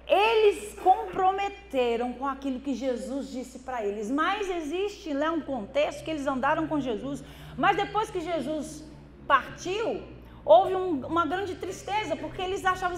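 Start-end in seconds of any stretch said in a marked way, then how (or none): none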